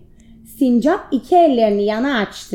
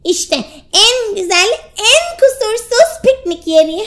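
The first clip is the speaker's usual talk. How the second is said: much higher, thrilled squirrel; excited; 10 semitones higher, 3 dB louder than usual. Acted. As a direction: announce the find with joy. (heyecanlı sincap)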